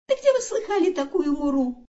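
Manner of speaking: high-pitched little joey parroting his mother; kangaroo son. A narrator's reading. Kangaroo son echoes mother from the pouch, high voice. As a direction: squeak it out high, copying mum. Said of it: "буркнул из сумки сынок кенгуру".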